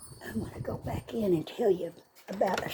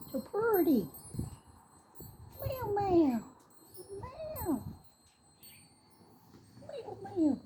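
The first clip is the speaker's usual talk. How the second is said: talking to cat; 13 semitones higher, 4 dB quieter than usual. Real, not acted. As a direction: coo and meow to the cat in a high voice. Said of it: "She likes it when I talk to her".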